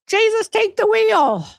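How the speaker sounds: high, slow mock plea; dramatic plea. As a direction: cry out like a desperate plea. Exasperated exclamation reading chat.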